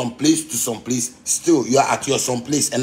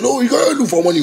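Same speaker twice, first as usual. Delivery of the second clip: high-pitched mock accusation; mock critic, pitched way up; imitating suspicious onlookers; 11 semitones higher, 4 dB louder than usual. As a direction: quote the doubters in a raised, high voice. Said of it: "anybody will see you".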